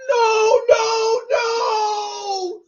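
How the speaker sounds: high anguished cries of no; anguished shout. Real, not acted. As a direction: wail it out in disbelief. Draft pick reaction, cry heard.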